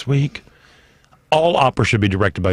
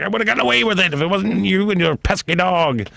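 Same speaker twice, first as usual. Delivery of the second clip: cartoon villain; 11 semitones higher than usual. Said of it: "that would've been me".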